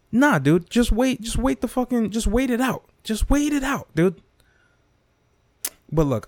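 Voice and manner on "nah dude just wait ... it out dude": higher-pitched mock New York comic, unbothered; mock laid-back comic. New York comics on the scandal, mock higher calm voice. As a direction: imitate a chill comic brushing it off. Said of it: "New York comics are like".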